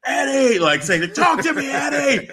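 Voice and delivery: high, urgent calling of Eddie; mock referee shouting in his face; referee pleading. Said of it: "the referee's in his face like".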